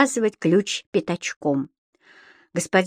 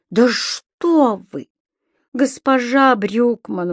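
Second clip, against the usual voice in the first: slow, drawn-out protest, a little higher; dismayed exclaiming; 4 semitones higher, much slower than usual. A narrator's reading. Tenant pleading with offended landlady, slow exclamation. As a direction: exclaim in mild dismay, stretching the words. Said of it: "воскликнул господин Пепперминт".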